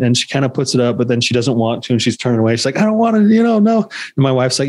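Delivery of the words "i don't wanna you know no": put-on much higher, pleading kid voice; whiny child. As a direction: whine like a scared little girl. Daughter refusing, voiced high.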